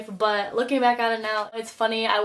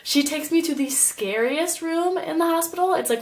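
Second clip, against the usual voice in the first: normal talking voice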